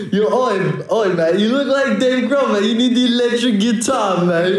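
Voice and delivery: high-pitched cartoon British heckler; mock British accent. Retelling British tourists hailing his dad, high mock British accent. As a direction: imitate excited Brits in a high voice. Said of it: "exactly like that. They're like".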